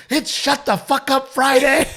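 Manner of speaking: high, loud group chant, half laughing; gleeful crowd shout; whole-table cheer. Retold or imitated. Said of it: "the whole table's going".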